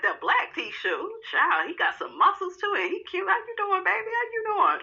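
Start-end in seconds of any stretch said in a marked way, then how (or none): 2.9-4.8 high, purring come-on voice; flirty greeting. Pretend. Host flirting with new bartender at bar.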